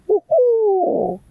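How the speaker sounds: high, long two-note owl call; owl hoot. Acted. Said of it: "And the owl went".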